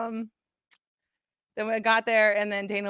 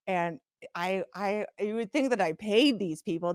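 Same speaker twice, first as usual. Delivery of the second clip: ordinary talk, nothing marked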